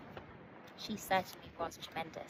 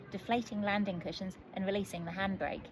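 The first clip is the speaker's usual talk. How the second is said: plain reading voice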